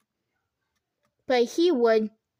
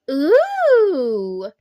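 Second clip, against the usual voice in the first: loud drawn-out ooh; impressed ooh; 2 semitones higher, 8 dB louder than usual. A narrator's reading. Women in the salon cooing, loud impressed ooh. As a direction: coo like impressed ladies. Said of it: "They echoed".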